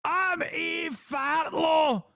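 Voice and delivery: high, slow, shouted mock Scottish; Scottish accent shout. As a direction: bellow it in a hammed-up Scottish brogue. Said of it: "I'm Scottish".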